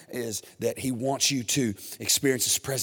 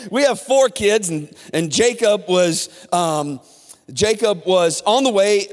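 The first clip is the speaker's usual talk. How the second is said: loud, higher, driving preacher voice; preaching at full force; 9 semitones higher, 9 dB louder than usual. Acted. Pastor stirring up the congregation, loud and driving. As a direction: fire up the congregation.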